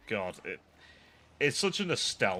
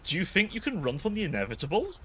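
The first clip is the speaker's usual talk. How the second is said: taunting villain; 9 semitones higher than usual. Pretend. Taunting fleeing enemy Carl.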